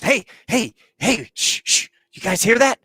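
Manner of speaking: high, insistent shushing; retold urgent hushing. Tony quiets everyone talking to hear distress call, urgent hushing.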